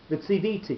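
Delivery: Vitsiviti with the stress on VEE, falling after it; normal stress. Shown as a demonstration. Teacher giving the normal accent, Vitsiviti stressed on next-to-last VEE. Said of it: "the normal way that you would accent this word".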